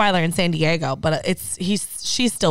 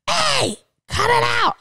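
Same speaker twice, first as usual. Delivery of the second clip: high, drawn-out mock yell; retold scream. Owner scolding her fighting dogs, retold scream. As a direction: yell it high, stretching the words. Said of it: "she hears me scream".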